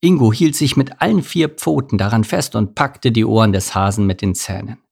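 usual speaking voice

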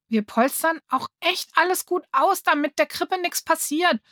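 put-on a boy begging the two kids, pleading. A narrator's reading. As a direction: plead earnestly to be allowed to help. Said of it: "sagte Gary und schaute flehend".

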